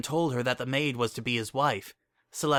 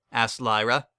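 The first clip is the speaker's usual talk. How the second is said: plain reading voice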